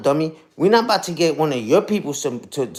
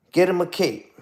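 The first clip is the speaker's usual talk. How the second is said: ordinary talk, nothing marked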